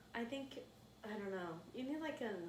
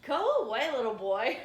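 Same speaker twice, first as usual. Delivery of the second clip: mock scornful shout; 3 semitones higher, 13 dB louder, slower than usual. Comic retelling how she shooed a clingy guy away, mock scornful shout. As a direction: shoo him off loudly, half laughing. Retold dismissal of boring guy.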